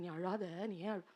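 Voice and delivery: slow and soft, hushing her tears; gently calm a crying lover; soothing. A narrator's reading. Samson hushes Delilah on his lap, slow and soft.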